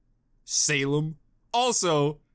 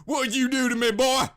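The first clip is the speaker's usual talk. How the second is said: higher, louder Southern protest; southern drawl; 9 semitones higher, 5 dB louder than usual. Pretend. Osbin protesting to the speaker in a Southern drawl. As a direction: complain in a mock Southern voice. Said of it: "You just got my southern drawl".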